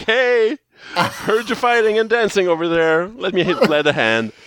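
lightly voiced cheerful Ninjor; breezy, slow eager helper; goofy hero voice. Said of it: "he's like".

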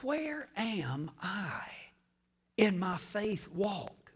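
whispered self-question; quiet inward whisper; soft whisper. Acted. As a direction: ask yourself under your breath. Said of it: "fill that in with me".

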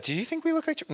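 high, soft voice for a retold question; self-conscious question. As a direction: imitate someone checking, voice lifted way up. Acting out self-conscious tourist question.

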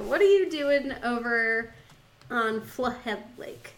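normal talking voice

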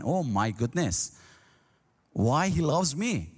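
ordinary delivery

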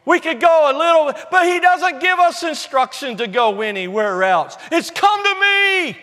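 high, loud, driving sermon delivery; ringing, emphatic proclamation; full-force preaching. Real, not acted. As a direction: build it like a fired-up preacher.

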